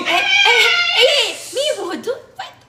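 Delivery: high, loud, laughing scold; yelling at the cats. Real, not acted. Owner yelling at her cats to stop fighting. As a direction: break up the cat fight.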